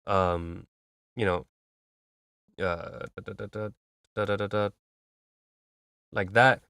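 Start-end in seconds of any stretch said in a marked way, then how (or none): 2.6-4.7 very soft "da da da" synth melody; mimicked synth line. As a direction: sketch the synth line with quiet syllables.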